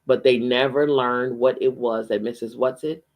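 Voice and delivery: ordinary delivery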